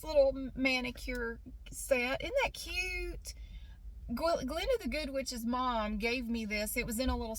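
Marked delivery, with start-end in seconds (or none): 2.2-3.3 cutesy. Real, not acted. Showing off a little manicure case.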